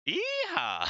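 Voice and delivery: a high, loud cowgirl whoop; screamed yeehaw. Retold or imitated. Counter woman screams at Larry, high loud yeehaw. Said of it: "she screams".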